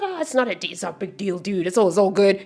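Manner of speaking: casual, dismissive dude impression; imitating nonchalant guy. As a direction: brush it off like an unbothered guy. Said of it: "they're so nonchalant sometimes".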